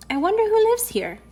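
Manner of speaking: put-on very high, wondering child voice; curious little girl. A narrator's reading. Girl outside a stranger's house, high curious wondering.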